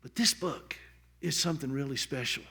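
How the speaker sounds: usual speaking voice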